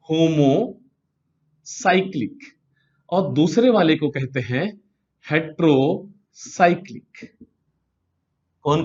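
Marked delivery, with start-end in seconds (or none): 0.1-2.4 stretched out syllable by syllable; slow dictation. Real, not acted. Teacher giving students the first type's name, slow dictation. Teacher introduces and writes new term. 5.3-7.4 drawn out with a break mid-word; slow dictation. Real, not acted. Teacher giving students the second type's name, slow dictation.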